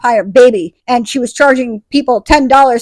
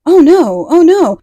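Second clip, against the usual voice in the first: high, louder mock alarm; mock dismay; 6 semitones higher, 5 dB louder, slower than usual. Jenna hears the warning about vampires, mock dismay.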